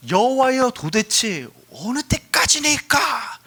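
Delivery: high, drawn-out cry of protest; acted outcry of complaint; crying out to God. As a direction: plead loudly with God, stretching the last words. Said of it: "소리 지르는 장면".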